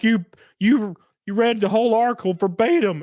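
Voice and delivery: high, mocking voice of a critic; whiny imitation of online critics. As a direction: parrot the accuser up high. Mocking critics in retold argument.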